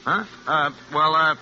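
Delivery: stammering, throat-clearing evasion; flustered stalling. Acted. Man caught out by her question, stammering to dodge it.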